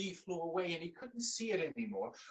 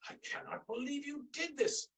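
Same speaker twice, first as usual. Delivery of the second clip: higher, stunned disbelief; amazed king; 9 semitones higher than usual. Acted. King sees the eaten steaks, amazed. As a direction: marvel at the empty platters.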